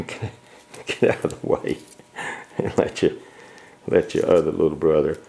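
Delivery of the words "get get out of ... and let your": breathless words through real laughter; laughing. Real, not acted. Owner laughing as she shoos the big puppy away. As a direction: laugh while you shoo him aside.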